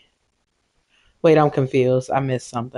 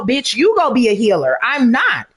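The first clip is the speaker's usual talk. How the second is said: ordinary talk, nothing marked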